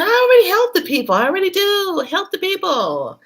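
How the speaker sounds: high, fast, defensive squeal; mock-offended high-pitched objection; indignant protest. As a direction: protest like you're insulted, high and quick. Retold protest to associate.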